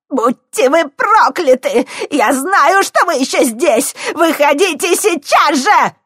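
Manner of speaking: put-on loud, high raging old woman; frantic shouting crone. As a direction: curse and scream at hidden thieves. Old woman rages at hidden thieves. (истеричные проклятия старухи)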